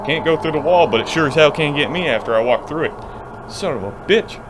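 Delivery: usual speaking voice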